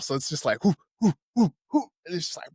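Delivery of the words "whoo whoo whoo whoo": high, loud whooshing noises. Retold or imitated. Slow-motion punch sound effect.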